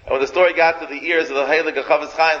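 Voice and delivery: usual speaking voice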